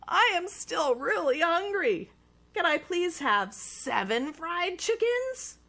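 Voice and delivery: put-on high, pleading boy voice; hungry little boy; boy asking. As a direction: beg politely like a hungry kid. Child begs.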